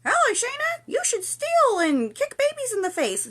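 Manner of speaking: very high, loud squeaky toy voice; teddy bear voice. Pretend. Teddy bear tempting a friend to be bad, squeaky toy voice.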